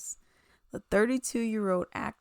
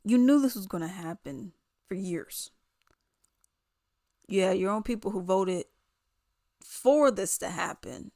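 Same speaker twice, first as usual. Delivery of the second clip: ordinary delivery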